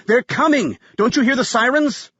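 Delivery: high-pitched panicked shout; yelling. A narrator's reading. Graham warns Jolene of sirens, urgent yell. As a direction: shout a frantic warning. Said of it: "Graham yelled".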